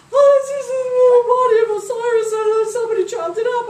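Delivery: high, loud wailing sing-song; sing-song lament. Acted. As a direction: lament it in a melodic wail. Isis sings out her grief.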